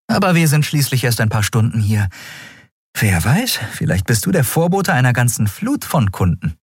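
mildly practical captain; easygoing captain. A narrator's reading. Captain answers the new boy.

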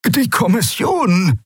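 high, slow anxious croak; fearful croak. Acted. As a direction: croak it out in fear. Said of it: "krächzte Rubandra ängstlich". (ängstlich krächzend)

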